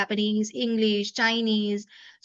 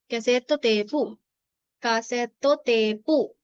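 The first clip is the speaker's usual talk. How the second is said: कैसेटो टेपु slowly, every syllable; slow demo; much slower than usual. Shown as a demonstration. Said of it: "कहते हैं कैसेटो". (कैसेटो टेपु धीरे)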